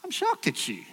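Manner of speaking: a light touch of a disappointed parent; parent scolding. Retold or imitated. Preacher imitating a parent's disappointed scold. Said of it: "my mom's voice... or my dad's voice saying".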